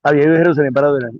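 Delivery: rushed reading. Acted.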